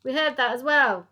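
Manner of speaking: shouting. Acted. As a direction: call it through the door. Police through door.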